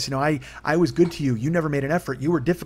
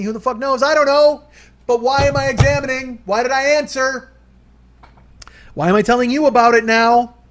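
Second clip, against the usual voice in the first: much higher, much louder frantic yelling at himself; frantic shouting; 12 semitones higher, 12 dB louder than usual. Retold or imitated. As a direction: yell it, losing control, spiralling. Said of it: "and I was like".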